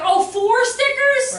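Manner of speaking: high, loud, slow fake amazement; mock delight. Boy offered a fourth sticker, hammed-up loud mock delight. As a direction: gush it with heavy sarcasm. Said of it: "he'd be like".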